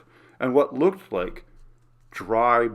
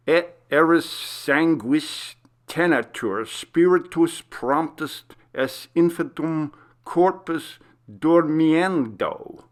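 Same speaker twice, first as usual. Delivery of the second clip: incantation; 5 semitones higher, 3 dB louder, slower than usual. Acted. Reads a Latin spell aloud.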